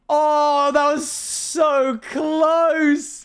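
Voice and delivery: high, slow, breathless gasp; gasping relief. Real, not acted. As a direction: gasp at the near miss. Near miss on roulette.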